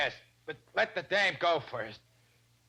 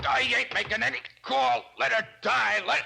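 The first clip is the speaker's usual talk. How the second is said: higher, raised defiant refusal; defiant outburst; 7 semitones higher, 3 dB louder than usual. Acted. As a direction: spit it back in defiance. Defiant thug under threat.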